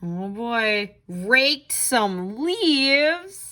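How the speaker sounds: ordinary delivery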